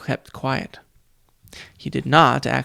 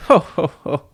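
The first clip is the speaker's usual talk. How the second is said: hearty booming fake laugh; deep mock "ho ho ho"; 3 semitones higher, 5 dB louder than usual. A narrator's reading. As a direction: laugh like a fake jolly giant. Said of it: "Nero laughed".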